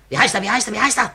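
urgent demand. Acted.

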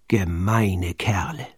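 langsam, tonlos geflüstert; leise geflüstert. A narrator's reading. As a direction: ganz leise vor sich hin flüstern. Pitt empört über die Piraten. (Pitt flüstert empört über die Piraten)

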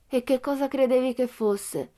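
put-on unbothered child asking back; calm girl voice. A narrator's reading. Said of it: "la voce calma di Pippi". (voce calma di bambina, più acuta)

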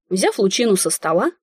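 plain reading voice

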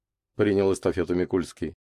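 usual speaking voice

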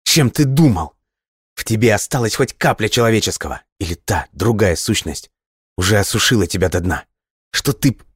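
high, rising furious outburst building up; escalating angry shout. Acted. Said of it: "её голос сорвался на крик".

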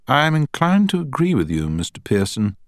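high-pitched, measured judge's voice; courtroom judge. A narrator's reading.

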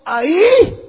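high, loud acted yell; short imitated scream; crying out. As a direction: cry out like a tormented soul.

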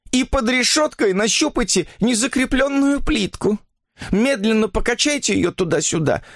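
high-pitched, weary confession; worn-down woman giving in; distressed sick woman. A narrator's reading.

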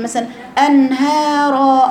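أنهارا with the ha from the back of the throat; slow, clear ها in أنهارا; deep ha. Shown as a demonstration. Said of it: "أقول لك دي أقصى الحلق". (هاء من أقصى الحلق)

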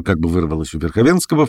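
plain reading voice

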